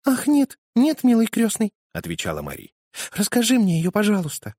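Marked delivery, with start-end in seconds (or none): 0.1-1.7 put-on high, loud sweet girl's voice; a small girl begging her godfather, pitched way up; little girl pleading. Little girl Marie answers. 3.2-4.5 put-on a small girl begging her godfather, pitched way up; little girl pleading. Little girl Marie asks.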